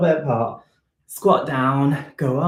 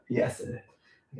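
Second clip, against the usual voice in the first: whispered aside; 10 dB quieter, slower than usual. Real, not acted. Answers self while checking camera.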